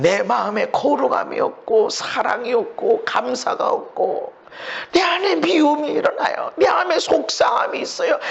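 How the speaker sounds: voiced sinner's tearful prayer, building up; tearful confession. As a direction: cry out to the Lord like a broken sinner.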